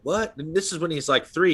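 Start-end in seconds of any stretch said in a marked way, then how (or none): 0.0-0.4 retold surprise. Parents reacting to odd claim.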